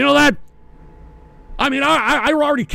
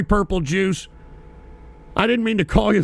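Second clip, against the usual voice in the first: normal talking voice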